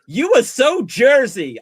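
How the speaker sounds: put-on loud, high, drawn-out American accent. Americans teasing the speaker about Jersey, in a loud American accent. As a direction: tease in a big slow American accent. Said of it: "And they were like".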